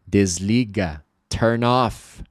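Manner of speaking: ordinary talk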